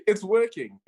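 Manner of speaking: said through a laugh. Real, not acted. Laughing reply.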